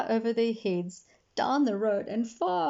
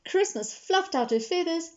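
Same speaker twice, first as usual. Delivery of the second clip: ordinary delivery, nothing marked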